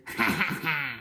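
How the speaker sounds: a gloating villain's laugh; smug crocodile laugh; laughing out loud. A narrator's reading. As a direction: laugh it off with mocking glee. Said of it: "laughed out loud".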